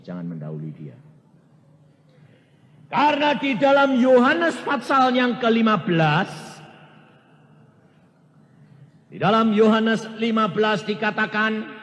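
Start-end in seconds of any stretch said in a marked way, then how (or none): 2.9-11.7 loud, high preacher's proclamation; forceful preaching. Acted. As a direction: proclaim the scripture to the congregation. Preaching scripture at full force. (khotbah berapi-api, keras)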